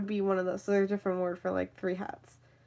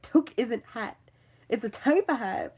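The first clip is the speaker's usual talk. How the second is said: insistent correction; 3 semitones higher, 5 dB louder than usual. Retold or imitated. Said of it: "and I'm like".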